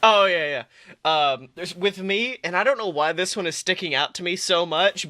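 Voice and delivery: usual speaking voice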